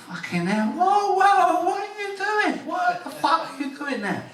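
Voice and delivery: very high, slow squeal of alarm; hammed-up outraged girl; mock shriek of a horrified girlfriend; shocked girl voice. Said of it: "She cried".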